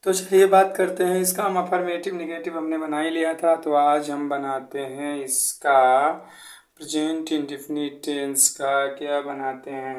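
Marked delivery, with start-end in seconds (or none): none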